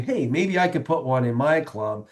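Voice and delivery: usual speaking voice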